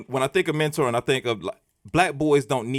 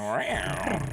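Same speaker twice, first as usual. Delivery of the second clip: cat meow; 7 dB quieter than usual. Pretend. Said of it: "do a little tiger".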